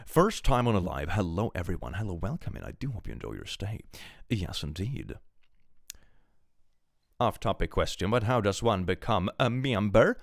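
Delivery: ordinary talk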